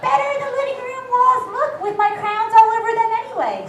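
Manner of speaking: put-on a drunk kindergartner talking; drunk kid. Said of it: "said the drunk kindergartner".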